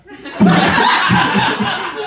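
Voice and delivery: loud breathless wheeze; wheezing laugh. Real, not acted. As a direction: crack up out loud.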